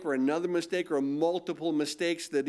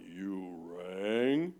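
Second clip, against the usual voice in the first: very deep, slow butler's drawl; Lurch voice; 11 semitones lower, 6 dB quieter than usual. Retold or imitated. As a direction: sink down into a gloomy butler's voice. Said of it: "picked up the phone and went".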